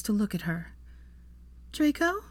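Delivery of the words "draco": high rising startled call; disbelieving gasp of his name; surprised. A narrator's reading. Startled realizing he is awake.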